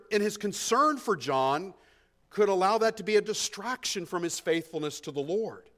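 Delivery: ordinary talk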